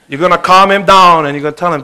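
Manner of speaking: ordinary talk, nothing marked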